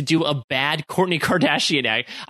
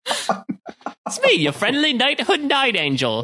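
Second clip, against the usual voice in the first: high hammed-up British hero voice, laughing; a giggly fake British voice; mock British accent; 9 semitones higher, slower than usual. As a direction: announce yourself like a silly British superhero. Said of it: "doing our Night Angel impressions".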